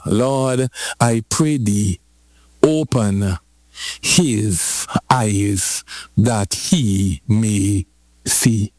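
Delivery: low, very measured praying voice; deep, deliberate scriptural petition; solemn prayer. Acted. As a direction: pray slowly, word by word. Quotes the prophet's prayer.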